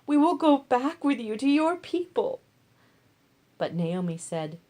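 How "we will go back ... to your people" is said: higher, louder tearful plea; weeping plea. Acted. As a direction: beg through tears, not wanting to part. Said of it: "they wept aloud and said to her".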